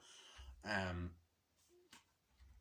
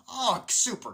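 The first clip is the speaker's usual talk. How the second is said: mock excited; 13 semitones higher, 10 dB louder, slower than usual. Retold excited invitation in quote.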